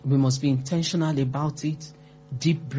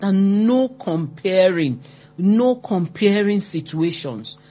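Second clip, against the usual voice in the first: ordinary talk, nothing marked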